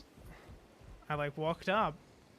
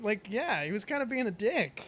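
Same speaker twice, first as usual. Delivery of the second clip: ordinary talk, nothing marked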